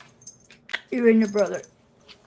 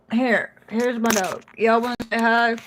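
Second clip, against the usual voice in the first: ordinary delivery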